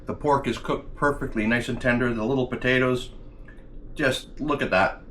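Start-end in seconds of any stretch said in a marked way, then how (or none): none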